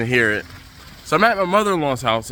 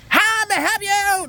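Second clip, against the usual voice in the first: high, fake-cheerful yell; mock counter yell. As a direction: shout it like a counter worker taking orders. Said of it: "they yell at you".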